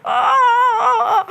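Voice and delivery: high wailing sobs; loud acted sobbing. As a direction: wail out loud like a crying child. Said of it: "nangis dia". (tangisan keras meraung)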